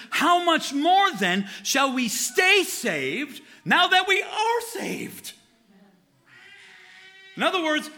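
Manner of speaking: rising to a fervent peak; getting carried away preaching; worked up. Real, not acted. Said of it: "I'm getting worked up".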